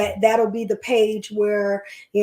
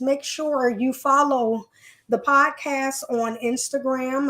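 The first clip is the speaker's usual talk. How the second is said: normal talking voice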